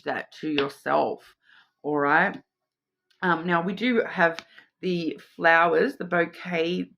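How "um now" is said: ordinary talk, nothing marked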